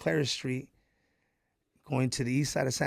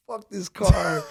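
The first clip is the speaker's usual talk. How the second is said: dismissive dad; 5 semitones higher, 8 dB louder, much slower than usual. Retold or imitated. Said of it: "He's like".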